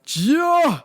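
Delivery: high, sing-song griping; exaggerated exasperation in dad's voice; fed-up dad. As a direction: mimic dad whining his way to an outburst. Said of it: "my dad's like".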